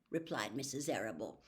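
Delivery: plain reading voice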